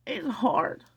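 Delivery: high voice breaking with empathy; real tears rising in the voice; tearful. Real, not acted. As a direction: choke up as the pitch climbs. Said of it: "These are all happy tears".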